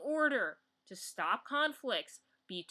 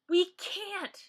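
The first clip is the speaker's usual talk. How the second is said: higher and louder, pressing the point; emphatic, exasperated we can't; forceful insistence; 8 semitones higher, 5 dB louder than usual. Real, not acted. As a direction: insist hard on it. Repeats, first time forcefully.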